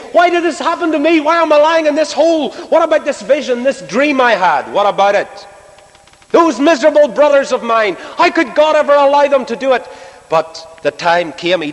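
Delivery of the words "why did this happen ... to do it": high-pitched acted protest; imagined bitter complaint. Man left in a hole questioning God, high indignant complaint. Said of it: "he didn't talk like that".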